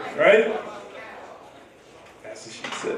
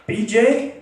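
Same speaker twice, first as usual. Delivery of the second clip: tentative, higher-pitched questioning offer; confused but hopeful; 6 semitones higher than usual. Retold or imitated. Stranger answering what kind of action, confused but hopeful. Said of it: "replied with a confused but hopeful face".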